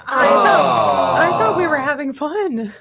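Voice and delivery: high, loud, hurt protest; high wounded mock protest. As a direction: cry out high that it was only fun. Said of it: "I was like".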